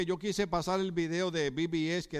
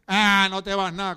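scoffing husband; 2 semitones higher, 9 dB louder than usual. Retold or imitated. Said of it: "hay esposos que dicen".

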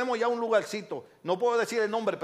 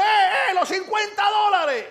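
high, loud shout across the street; calling out; 12 semitones higher, 8 dB louder than usual. Retold or imitated. Creditor chases a debtor onto a moving bus, shouting after him. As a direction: holler after someone getting away.

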